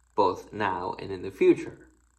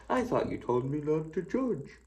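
higher, put-on voice of a smug objector; objecting churchgoer; 7 semitones higher than usual. Preacher voicing a skeptical congregant's retort, higher mock voice. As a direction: mimic a listener catching the preacher out. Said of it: "Somebody out there is gonna say that".

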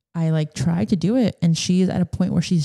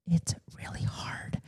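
breathy whisper, much softer, slow; 13 dB quieter than usual. Real, not acted. Mom admits work-from-home is hard, whispering. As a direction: whisper it like a confession.

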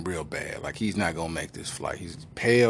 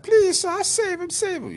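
very high, loud mock woman's plea; 4 dB louder, slower than usual. Woman pleading with the doctor, very high mock plea. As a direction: beg like a frantic wife.

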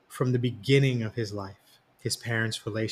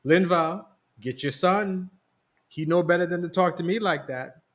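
higher, warning mother tone; that mom voice; 6 semitones higher than usual. A narrator's reading. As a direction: put him in his place, mom style. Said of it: "Mom said with that voice".

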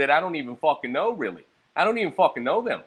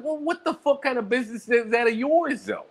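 irritated retort; 9 semitones higher than usual. Retold or imitated. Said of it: "And I was like".

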